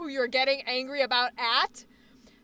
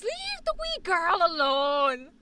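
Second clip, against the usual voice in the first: slow, drawn-out Irish scolding; Irish grandpa; 3 semitones higher, slower than usual. Acted. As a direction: stretch it out in a strong Irish accent. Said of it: "favorite thing he used to say".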